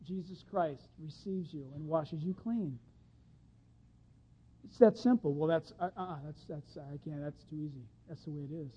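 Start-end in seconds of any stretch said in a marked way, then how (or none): none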